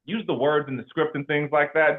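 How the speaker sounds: ordinary talk, nothing marked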